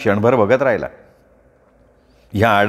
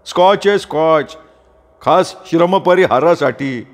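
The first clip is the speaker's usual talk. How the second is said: higher, hearty voice of Sopan; hearty farmer friend; 5 semitones higher than usual. Acted. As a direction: boss the wife cheerfully for his friend. (बायकोला हौसेनं सांगणारा सोपान)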